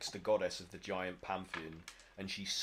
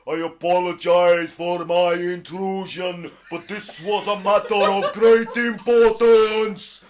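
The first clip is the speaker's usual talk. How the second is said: loud, high, slow courtly giant voice; formal giant; 10 semitones higher, 20 dB louder, slower than usual. Acted. Giant apologising to the pixies, loud and slow. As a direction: apologise grandly like a huge polite giant. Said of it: "he's like".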